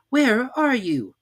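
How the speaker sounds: put-on high, eager boy's voice; an excited little boy; Christopher Robin voice. A narrator's reading. Robin calling.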